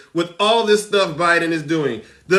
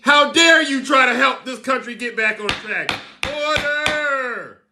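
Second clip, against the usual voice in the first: mock outrage; 5 semitones higher than usual. Mock outrage at Biden news.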